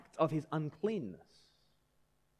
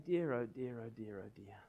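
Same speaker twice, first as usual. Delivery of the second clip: a sighing "oh dear", fading softer to the end; sighing mock lament; 4 semitones lower, 6 dB quieter than usual. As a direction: sigh it out, regretful and fading. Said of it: "I just sigh and say".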